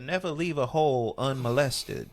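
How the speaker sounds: a hint of a Southern granny accent; granny's Southern saying. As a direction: quote granny with a light Southern twang. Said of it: "my granny always said".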